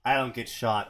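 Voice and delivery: ordinary talk, nothing marked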